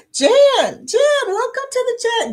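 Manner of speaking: high, bright welcome call; excited greeting. Real, not acted. Host welcoming Jan to the live chat, excited. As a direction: sing out a welcome to a newcomer.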